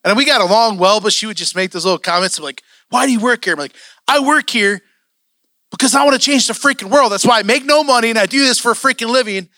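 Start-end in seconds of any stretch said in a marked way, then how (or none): 2.9-3.5 high, louder exasperated challenge; indignant outburst; mad. Retold or imitated. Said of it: "I would get so mad". 4.1-9.5 loud, high-pitched indignant rant; retold angry outburst, voice pushed up; so mad. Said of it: "I would get so mad".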